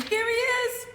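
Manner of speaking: high, loud burst of excitement; excited reveal. Real, not acted. Teacher revealing the leprechaun page to her class, excitedly. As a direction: announce the best page like a treat.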